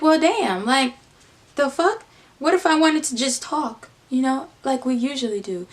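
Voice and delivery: high-pitched, affronted retort; indignant protest. Retold or imitated. Her reacting as he leaves the car, retold indignant protest. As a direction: protest the brush-off, pitched well up.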